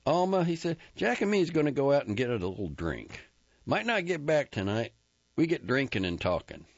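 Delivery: keyed-up husband talking fast; hurried Ennis. Acted. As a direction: rush the excuse out the door. Ennis rushes out excuse.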